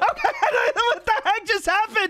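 high, laughing outburst; exasperated disbelief. Real, not acted. Player reacting to the game crashing, exasperated high outburst. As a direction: shout it in real disbelief, pitch way up.

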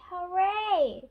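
high, loud storybook cheer. A narrator's reading. George cheers as Polly saves the day, cheering. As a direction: let out a drawn-out hooray. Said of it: "George cheers".

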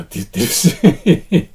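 loud, breaking up into laughter; real amused laugh taking over the words; laughing at oneself. Real, not acted. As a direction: crack up at your own slip mid-phrase. Catches own verbal tic, laughs.

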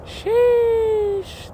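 squealed high and loud; a shrill amazed sheesh; high-pitched sheesh. Real, not acted. Amazed at powerful sprinklers.

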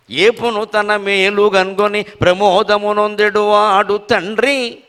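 slow, chanted poem line; verse recitation. Acted. Reciting Chintamani's verse to the audience, chanted slowly. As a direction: draw out every syllable of the verse.

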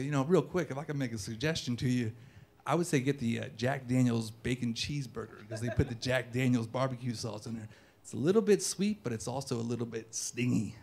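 low, soft flirtatious pitch; kicking game. Acted. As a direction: recommend it quietly, like a smooth come-on. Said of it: "as I'm kicking game again".

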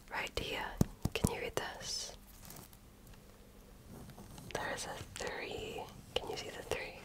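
normal talking voice

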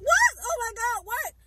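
high, loud shocked gasp of words; stunned disbelief. Retold or imitated. A worker retelling her own panic as the boy collapsed. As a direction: cry out in sudden confusion.

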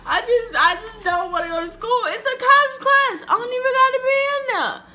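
put-on teen crying out to parents; crying rant. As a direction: yell it through tears. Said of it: "I am crying".

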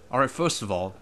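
ordinary talk

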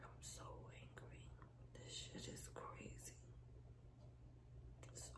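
soft, unvoiced, held-in anger; seething whisper. Real, not acted. Venting anger to viewers, a seething whisper. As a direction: hold the anger down to a breath.

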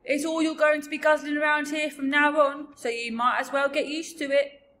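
loud, high-pitched giant insistence; big giant voice, hectoring; bossy giant. Acted. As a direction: push it on the listener loudly. BFG urges Sophie to eat.

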